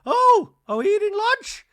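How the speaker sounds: very high, loud squeal; squeaky surprise. Retold or imitated. Dorit squealing in surprise, very high. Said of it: "Dorit's like".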